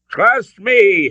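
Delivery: very high, loud urgent shout. A narrator's reading. Father urges his son in standoff, urgent shout. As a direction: shout to win his trust. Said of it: "Henry shouted".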